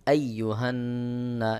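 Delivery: long nasal hum on the n of "আইয়ুহান্না"; ghunnah. Shown as a demonstration. Teacher shows obligatory ghunnah, "আইয়ুহান্না" with held nasal n. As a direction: hold the doubled n in "আইয়ুহান্না" through the nose. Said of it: "এখানে গুন্নাহ করতে হবে".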